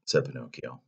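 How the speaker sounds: ordinary delivery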